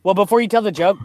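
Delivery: loud, fast, cutting in before the joke. Real, not acted. Cutting in before a friend's joke, loud and rushed. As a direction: jump in quickly and loudly to stop him. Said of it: "louder first take".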